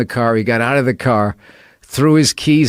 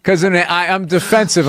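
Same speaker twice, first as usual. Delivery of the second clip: ordinary talk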